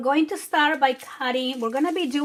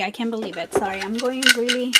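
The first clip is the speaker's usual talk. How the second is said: normal talking voice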